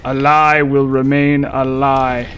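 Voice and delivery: slow, solemn quote with a hint of Aldia; quoting Aldia. Streamer quoting Aldia's line, lightly voiced and solemn. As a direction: intone it slowly, like a grave lore line. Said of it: "as Aldia says".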